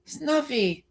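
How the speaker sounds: very high, strained Big Bird call. Shown as a demonstration. Streamer attempting Big Bird calling Snuffy. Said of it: "That's the best I can do".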